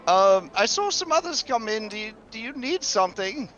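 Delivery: high, nervous clerk; fretful teller, pitched high; worried bank teller. Acted. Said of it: "this guy heads back and says".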